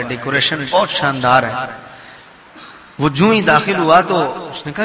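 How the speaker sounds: normal talking voice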